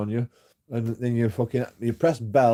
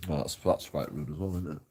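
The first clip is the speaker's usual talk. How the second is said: ordinary talk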